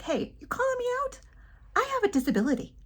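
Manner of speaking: mock indignant, pitched high; rude confrontation. Speaker imagines snapping back at whoever called her out, rude. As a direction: play at calling someone out rudely. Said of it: "I could be really rude".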